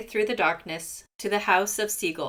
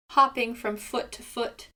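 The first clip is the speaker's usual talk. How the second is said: ordinary delivery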